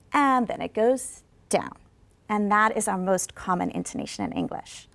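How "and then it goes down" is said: slowly falling, down at the end; slow falling pitch; slow falling tune. Shown as a demonstration. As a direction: draw it down slowly. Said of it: "intonation that goes down".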